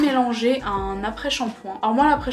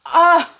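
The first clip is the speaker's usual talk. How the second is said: startled yelp; 7 semitones higher, 12 dB louder than usual. Real, not acted. Startled cry after whisper.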